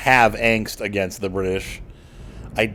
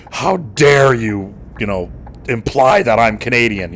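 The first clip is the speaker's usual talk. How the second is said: hammed-up indignant huff, louder and higher; mock outrage; 4 semitones higher, 8 dB louder than usual. To someone mistaking him for Canadian, mock outraged. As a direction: play a person insulted at being called Canadian. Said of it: "But I wouldn't be like".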